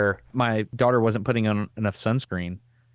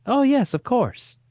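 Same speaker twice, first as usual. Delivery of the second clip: much higher, slow and sing-song; Paulina's casual reply; 4 dB louder, slower than usual. Retold or imitated. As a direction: toss it off lightly, high and slow.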